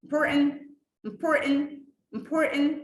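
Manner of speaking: "important" with the middle t swallowed, as in an American or British accent. Shown as a demonstration. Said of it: "دي American or British accent". ("important" بلكنة أمريكية أو بريطانية مختصرة)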